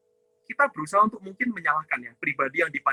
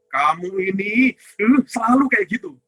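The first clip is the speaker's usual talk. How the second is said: a touch louder, scolding someone who always does this; mock angry scolding; 2 semitones higher, 5 dB louder than usual. Shown as a demonstration. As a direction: demonstrate an angry outburst, half-acted. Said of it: "misalnya saya marah".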